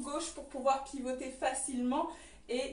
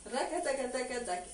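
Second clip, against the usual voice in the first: rapid "rakataka" percussion with the mouth; rhythmic vocal rattle; 2 semitones lower than usual. Shown as a demonstration. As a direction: sound out the hip trembling beat. Teacher voices the trembling rhythm.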